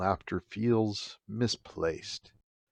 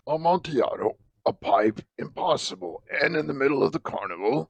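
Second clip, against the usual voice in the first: higher, excited tipsy reveller; drunken disbelief; 7 semitones higher than usual. Acted. Drunken Fortunato doubting the Amontillado, higher and excited.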